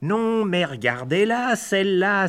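mocking high exclamation; scornful hen blackbird. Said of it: "s'est écriée une autre merlette".

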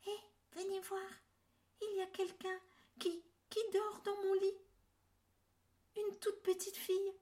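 high, small little bear voice; tiny bear voice. A narrator's reading. (toute petite voix d'ourson)

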